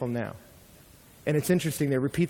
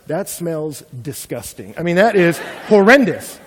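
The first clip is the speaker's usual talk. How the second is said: rude dinner guest; 3 semitones higher, 9 dB louder than usual. Retold or imitated. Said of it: "and I went".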